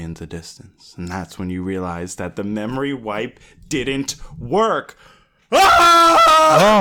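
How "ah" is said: high loud mock scream. Narrator reacting to the twist ending, mock scream. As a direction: shriek for the twist.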